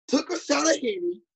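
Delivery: high put-on Southern coach voice reading rap; mock Southern coach. Reading the coach's lyrics aloud in a mock Southern coach accent. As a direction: read it like a Southern coach who doesn't get the slang. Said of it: "let me read this to you".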